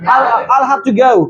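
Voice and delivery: slow mock American English, go said like गाओ. Shown as a demonstration. Teacher jokes that American English says गाओ, slow mock American English. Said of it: "गाओ को American English में बोलते हैं गाओ".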